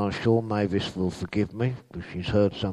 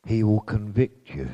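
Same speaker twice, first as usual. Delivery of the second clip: usual speaking voice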